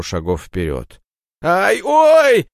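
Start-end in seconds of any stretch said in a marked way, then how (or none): 1.4-2.5 high, loud squeal of pain; shrill squeal. Acted. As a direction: squeal as the knife cuts. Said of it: "заверещал неуклюжий бедолага".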